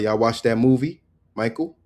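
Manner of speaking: ordinary delivery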